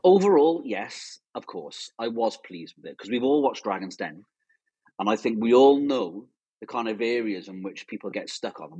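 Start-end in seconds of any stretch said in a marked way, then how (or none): none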